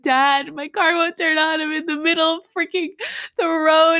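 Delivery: whiny, acted-out crying; retold crying. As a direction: imitate yourself wailing down the phone. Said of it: "I'm just crying".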